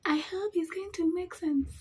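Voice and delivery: high playful voice; high put-on voice. Real, not acted. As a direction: say it high and silly. Repeats hope in a put-on voice.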